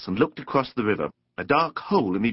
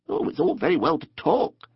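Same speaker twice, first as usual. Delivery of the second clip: higher, mildly huffy Mole voice; pettish; 6 semitones higher than usual. A narrator's reading. Mole answers Rat's invitation to come over, pettishly. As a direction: grumble it a little peevishly. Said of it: "said the Mole rather pettishly".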